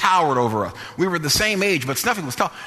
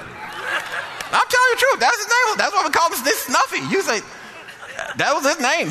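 laughing; 10 semitones higher than usual. Real, not acted. Laughing while recalling Snuffy's nose.

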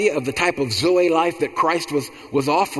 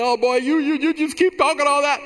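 mama's exasperated voice; 13 semitones higher than usual. Retold or imitated. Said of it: "And she said".

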